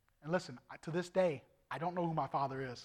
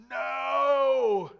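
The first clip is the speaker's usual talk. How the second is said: shouted no; 9 semitones higher, 12 dB louder than usual. Retold or imitated. Refusing God's tug.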